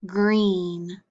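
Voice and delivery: normal talking voice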